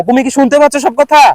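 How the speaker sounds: yelling a hearing check to a teammate; calling out. Real, not acted. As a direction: shout the question to someone far away. Calling to teammate over distance.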